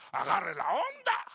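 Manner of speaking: high, slow indignant echo; scandalized quoting back. Retold or imitated. Churchgoer throwing the pastor's words back at him, mock scandalized. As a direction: mimic an offended woman repeating the slang. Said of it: "Y me llamó la atención".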